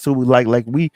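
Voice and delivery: ordinary talk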